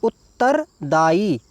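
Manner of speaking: उत्तरदायी said slowly, syllable by syllable; teacher dictating उत्तरदायी slowly; slow spelled-out word. Shown as a demonstration. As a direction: draw out उत्तरदायी clearly for dictation. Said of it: "क्या लिखना है".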